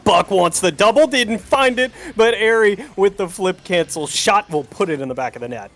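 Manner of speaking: ordinary delivery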